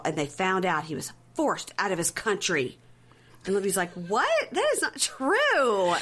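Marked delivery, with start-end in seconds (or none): 3.9-5.9 mock shocked disbelief, high and drawn out; Libby. Mother told he was forced out of his country, mock disbelief. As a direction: stretch it into an exaggerated incredulous wail.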